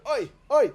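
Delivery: put-on high, loud groans of an old man jolted on a bumpy wagon; pained groans. Old peddler on the wagon groans under his heavy pack, high and loud. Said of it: "he's going".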